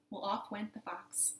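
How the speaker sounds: usual speaking voice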